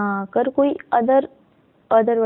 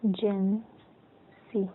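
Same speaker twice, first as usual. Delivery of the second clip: Agency very slowly, softer, syllable by syllable; sounding out; 7 dB quieter, much slower than usual. Shown as a demonstration. Teacher writes agency in shorthand, sounding it out slowly and softly. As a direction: split agency into ए, जेन, सी with long pauses. Sounding out word while writing it.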